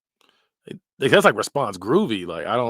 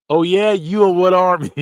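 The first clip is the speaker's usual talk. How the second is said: loud, higher incredulous outburst; mock disbelief; 7 semitones higher, 10 dB louder than usual. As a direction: blurt it out in fake shock. Mock reacting to saying.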